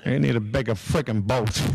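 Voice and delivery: low, gruff De Niro voice with a laugh; Robert De Niro impression. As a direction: mumble it like De Niro in Jaws. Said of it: "This is Robert De Niro".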